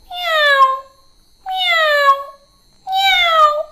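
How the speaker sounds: very high, thin cat meows; high-pitched mewing, gliding down; cat meowing. Shown as a demonstration. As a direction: meow "meow" like a real cat. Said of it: "എങ്ങനെയാ?".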